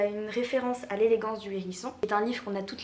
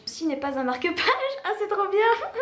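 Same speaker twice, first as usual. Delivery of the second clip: high, loud, laughing read-out; real amused excitement, rising into laughter; laughing delight; 9 semitones higher, 5 dB louder than usual. Real, not acted. Finding the joke bookmark, laughing with delight.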